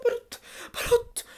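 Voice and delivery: slow, breathy pleading whisper; tense whisper. Real, not acted. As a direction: hiss it under your breath, willing it to jump. Urging the character to jump.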